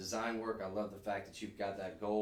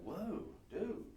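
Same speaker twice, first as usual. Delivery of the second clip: higher, stoked skater dude drawl; 7 semitones higher, 5 dB quieter than usual. Pretend. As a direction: gush like an awestruck skater. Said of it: "I sound like a skater dude".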